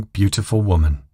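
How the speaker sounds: ordinary delivery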